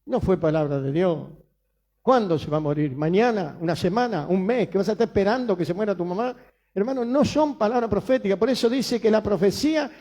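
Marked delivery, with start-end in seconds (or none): none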